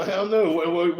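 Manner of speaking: ordinary delivery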